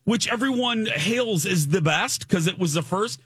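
ordinary delivery

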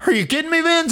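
very high retold comeback; Bradshaw. Bradshaw answering the boss's scolding, high incredulous imitation. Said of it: "He said".

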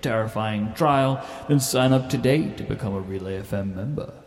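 upbeat promo announcer; ad read. Acted. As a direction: sell it like a trailer voiceover. Trailer ad read.

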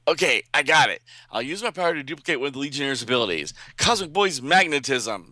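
cocky hero voice. Acted. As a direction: play the show-off boasting to his teammate. Duplicate Boy voiced in comic recap.

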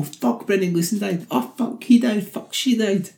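high-pitched mock realisation; mock viewer's dismay. Viewer at the end of the movie, high mock dismay.

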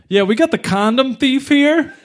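high, laughing mock-announcement; gloating cop. Cop shows off his catch to the station, gloating and high. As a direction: show the kid off to the whole station. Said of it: "he's like".

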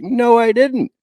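high, loud whiny protest; shrill mock denial. Mocking Tamra's whiny denial to Heather. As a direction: mimic a petulant child denying it. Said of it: "it's like".

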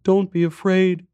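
put-on high, hushed-style child voice; small boy reassuring, pitched high; little boy's whisper. A narrator's reading. Boy reassures stranger in the dark, high child voice. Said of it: "came a little boy's whisper".

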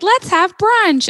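high, slow, drawn-out mock cheer; mock brunch crowd. Mocking everyone at restaurants, high drawn-out cheer. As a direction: stretch it out high like a gushing brunch fan. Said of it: "everyone's like".

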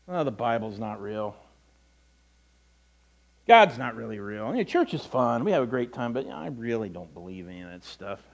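low, quiet dismissive mumble; offhand disbelief. Acted. Churchgoer who really doesn't believe any of it, offhandedly dismissive.

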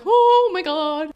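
high, drawn-out panicked moan; freaking out. Retold or imitated. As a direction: stretch it in rising panic. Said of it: "I'm like".

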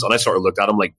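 ordinary talk, nothing marked

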